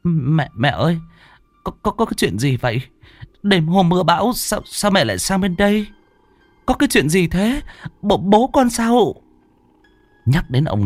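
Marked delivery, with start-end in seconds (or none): 0.0-9.1 high, frightened stammer; scared young wife stammering at her mother-in-law; stammering daughter-in-law. Acted. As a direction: stumble over the words in fear. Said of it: "chị Thu lắp bắp".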